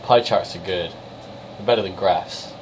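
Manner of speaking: ordinary talk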